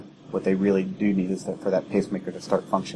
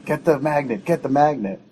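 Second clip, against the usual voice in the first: raised, higher-pitched imitation of a nurse's yell; imitated urgent yell; 7 semitones higher, 3 dB louder than usual. Senior charge nurse yelling in the emergency department, imitated yell. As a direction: call it out urgently, as if quoting someone yelling. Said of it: "one of the senior charge nurses yell".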